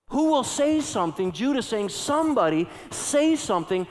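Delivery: rally them to act; urgent preaching. Acted. Preacher urging the congregation to rescue souls, urgent preaching.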